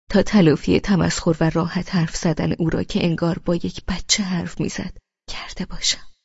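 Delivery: ordinary delivery, nothing marked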